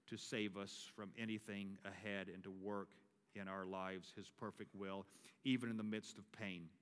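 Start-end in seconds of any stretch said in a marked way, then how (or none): none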